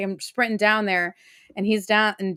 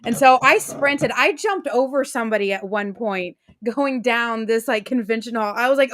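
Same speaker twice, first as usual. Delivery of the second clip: normal talking voice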